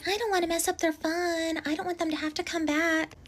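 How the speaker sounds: very high-pitched mimicry of a bride; mocking bride imitation. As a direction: imitate her in a silly high voice.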